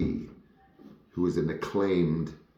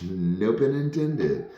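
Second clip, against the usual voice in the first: drawn-out, higher, laughing aside; amused aside; 6 semitones higher, 3 dB louder, slower than usual. Real, not acted. Actor catching own accidental pun about the Queen's English, amused aside. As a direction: chuckle through a slow, lifted aside.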